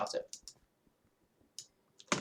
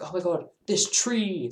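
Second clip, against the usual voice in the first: higher, slow, marvelling at a tree; awestruck; 9 semitones higher, 4 dB louder, slower than usual. Retold or imitated. Fan marvelling at a tree, slow, higher awe. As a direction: gasp over the tree like it's sacred. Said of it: "You're like".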